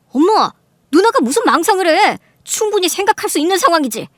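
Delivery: high, loud protest; heated objection. Acted. Brother defending his sister to their mother, heated. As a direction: object forcefully on her behalf.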